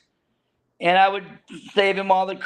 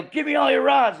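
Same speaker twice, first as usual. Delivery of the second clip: higher-pitched retold call; 6 semitones higher than usual. Deckhand collecting rods from passengers, calling out. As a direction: imitate hollering to a group.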